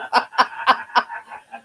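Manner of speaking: high cackling laugh; helpless giggling burst; real laughter. Real, not acted. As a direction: crack up after reading aloud. Amused by comment just read.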